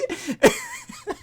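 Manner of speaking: shrill peal of laughter; high squealing laugh. Real, not acted. Cracking up.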